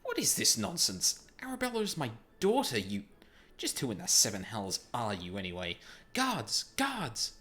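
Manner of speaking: furious nobleman calling the guards; outraged shout. Acted. Outraged father confronts stranger.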